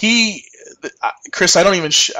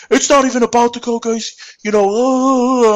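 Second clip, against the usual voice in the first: higher, shouted complaint from an irate customer; angry customer yelling; 8 semitones higher, 3 dB louder than usual. Retold or imitated. Angry customer yelling at the employee, higher shouted rant. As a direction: play the furious customer chewing him out. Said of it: "this guy just starts yelling at me".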